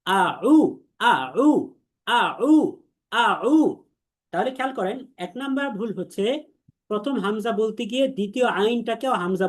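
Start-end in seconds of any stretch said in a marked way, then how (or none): 0.1-3.6 আ উ with a clear hamza আ then a throaty ain উ; careful teacher drill of আ উ; correct Arabic sounds. Shown as a demonstration. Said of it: "পরেরটা হচ্ছে আইন ওয়াও পেশ উ".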